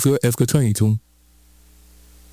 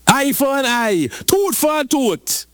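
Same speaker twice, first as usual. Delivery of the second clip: rising higher to a slow cry; high sharp quote; 10 semitones higher, slower than usual. Acted. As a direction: lift it high and stretch it out. Preacher quoting old law.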